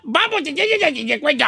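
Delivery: high start, words mashed into babble; garbled gibberish. Pretend. Host rattles off his survey catchphrase, garbled. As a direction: rattle it off as unintelligible syllables. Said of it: "No se le entiende".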